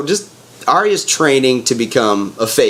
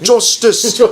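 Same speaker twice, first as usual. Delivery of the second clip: mock proclamation; 10 semitones higher than usual. Davos cheering execution in retelling.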